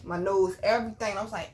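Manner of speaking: usual speaking voice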